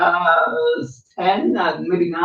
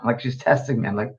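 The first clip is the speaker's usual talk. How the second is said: ordinary talk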